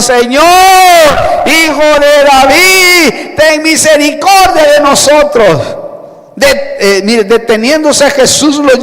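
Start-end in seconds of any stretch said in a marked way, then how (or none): 0.0-5.8 acted louder shouting. Blind men cry out over the crowd hushing them, insistent shout. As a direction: stretch out each cry, insistent and raised. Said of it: "pero ellos gritaban más aún diciendo".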